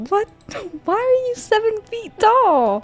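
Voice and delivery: high, slow, incredulous question; slow rising disbelief at the height; shocked. Real, not acted. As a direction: gape up at the giant. Shocked at tall visitor.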